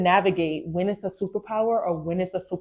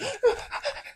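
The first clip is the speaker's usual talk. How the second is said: high gasp breaking into laughter; gasp, then laugh at yourself; 5 dB quieter than usual. Retold or imitated. Mocking her own shock, gasping and laughing.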